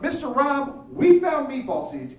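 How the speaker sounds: put-on high, loud thrilled kid voice; bursting with the news. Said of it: "Even more excited than the first kid".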